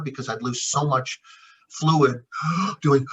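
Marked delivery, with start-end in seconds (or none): 2.3-2.8 sharp rising gasp for breath; imitated covid gasp; gasping for air. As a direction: gulp in air as if unable to breathe.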